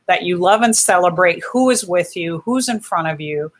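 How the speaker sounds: normal talking voice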